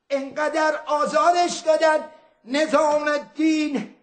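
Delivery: ordinary talk, nothing marked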